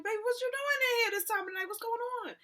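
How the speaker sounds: very high-pitched auntie voice; concerned auntie. Retold or imitated. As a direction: fuss over a young one like a surprised auntie. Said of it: "This is an auntie".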